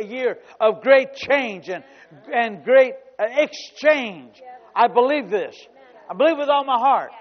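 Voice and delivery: full-force preaching. Acted. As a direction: drive each phrase home to the church.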